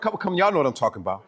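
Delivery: ordinary talk, nothing marked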